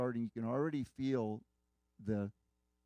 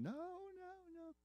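very high, soft mock scream; imitated screaming; 11 dB quieter, slower than usual. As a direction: imitate her screams in a small falsetto wail. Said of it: "She's screaming, yelling, kicking".

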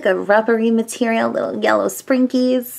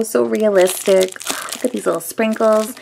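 ordinary talk

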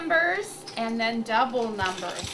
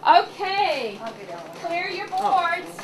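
bingo caller; 2 semitones higher than usual. Acted. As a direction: call out to the whole room. Calls second bingo game.

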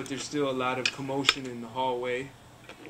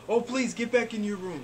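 higher-pitched, urgent nurse's plea; pleading nurse; 7 semitones higher than usual. Acted. Game master as nurse sending a patient back, pleading. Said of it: "the nurse runs to you".

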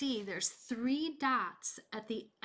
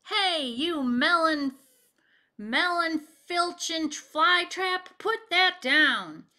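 put-on loud, high slow shouting old man; angry old gardener; 7 semitones higher, 6 dB louder than usual. Old man with pitchfork shouting at Bullfrog, loud. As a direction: bellow at the thief, slow. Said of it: "said an old man with a pitchfork".

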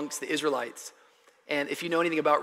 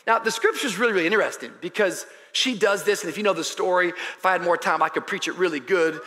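normal talking voice

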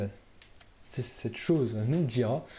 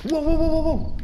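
much higher, louder burst of wows; startled shout; 9 dB louder than usual. Real, not acted. Startled by a loud noise during the ghost hunt. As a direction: jump at the sound and yelp.

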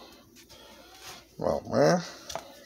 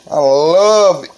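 excited; 7 semitones higher, 11 dB louder, slower than usual. Real, not acted. Enthusing over messy eating.

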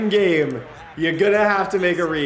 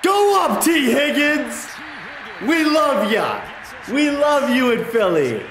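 high, loud, drawn-out yell of joy; ecstatic cheer; 10 semitones higher, 4 dB louder, slower than usual. Real, not acted. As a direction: scream praise at the receiver. Celebrating a big catch.

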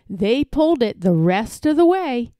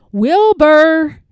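loud, higher drawn-out call; 5 semitones higher, 8 dB louder than usual. A narrator's reading. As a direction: call a name to someone out of sight. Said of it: "called Elmer".

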